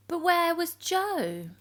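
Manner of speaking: high, slow questioning; playful puzzled narrator; wondering. A narrator's reading. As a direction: ask it wide-eyed and drawn out. Narrator asks with suspense.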